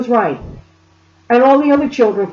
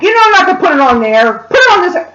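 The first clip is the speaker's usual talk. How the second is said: raised, imitated mother's telling-off; retold yell, climbing higher; mock scolding; 7 semitones higher, 5 dB louder than usual. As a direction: scold the kids as their mother.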